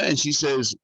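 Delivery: ordinary delivery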